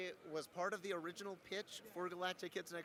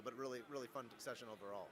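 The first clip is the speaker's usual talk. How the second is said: normal talking voice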